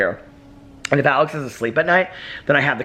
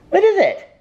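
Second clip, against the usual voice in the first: very high, louder sing-song question; baby talk to the dog; 4 dB louder than usual. Acted. Owner asking her dog what he wants, in high baby talk. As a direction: coo up high at the puppy.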